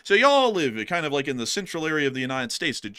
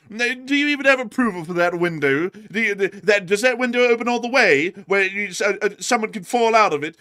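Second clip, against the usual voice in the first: huffy British busybody rant; hammed-up indignant British complainer, sputtering; British marmot; 3 semitones higher, 5 dB louder than usual. Marmot threatening to call the council, huffy British accent. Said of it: "all of our marmots are British".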